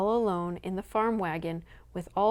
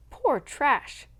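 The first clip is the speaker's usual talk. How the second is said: higher, louder scornful repeat; 6 semitones higher, 4 dB louder than usual. Acted. Mother scorning the townsfolk, higher scornful repeat. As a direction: spit the words out in contempt. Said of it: "trembling with anger and excitement".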